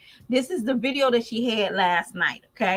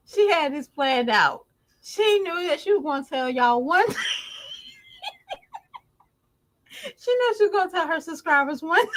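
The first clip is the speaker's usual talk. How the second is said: high laughing voice; words squeezed out through laughter; 8 semitones higher than usual. Real, not acted. Laughing fit while telling.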